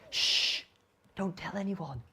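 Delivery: soft, confiding hush; hushed secret. Pretend. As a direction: shush them and keep it secret. Shares a secret with audience.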